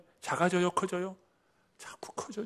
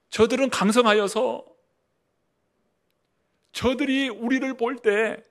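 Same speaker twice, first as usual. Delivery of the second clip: alarmed spies' report; 9 semitones higher, 8 dB louder than usual. Acted. Fearful spies' report acted.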